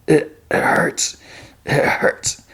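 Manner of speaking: slow, halting whispered hurt; pained whisper. Acted. Parker defeated, telling her it hurts, pained whisper. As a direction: whisper it haltingly through the pain. Defeated man saying he is in pain.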